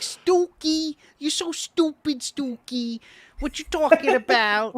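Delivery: high-pitched mock tirade, Brooklyn style; Rosie Perez. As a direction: squeal it like a fed-up Nuyorican girlfriend. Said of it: "you probably gonna sound like Rosie Perez".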